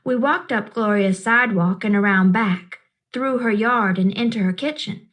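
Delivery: plain reading voice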